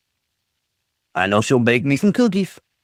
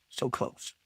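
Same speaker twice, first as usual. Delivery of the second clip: breathy whisper, almost to self; 16 dB quieter than usual. Real, not acted. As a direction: let it slip out under the breath. Near miss, repeats under breath.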